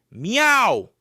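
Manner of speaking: loud high yowl; loud cat meow. Acted. Cat protests loudly.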